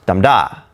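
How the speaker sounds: low "Dam da", strong then weak; trochee stress. Shown as a demonstration. Teacher showing opposite of an iamb, DAM-da stressed first. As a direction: hit "Dam", let "da" fall away. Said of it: "begins with a stress syllable".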